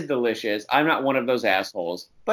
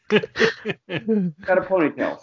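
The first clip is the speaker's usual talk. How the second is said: slow mock growl; 8 semitones higher, much slower than usual. Mimicking Thierry's gruff retort.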